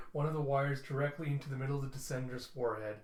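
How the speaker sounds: ordinary delivery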